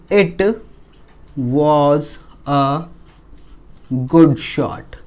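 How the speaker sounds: word by word with long pauses; slow dictation. Real, not acted. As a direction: dictate slowly, one word at a time. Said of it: "मैं slow slow बोलूँगा". (धीमे, शब्द-दर-शब्द बोलकर लिखवाना)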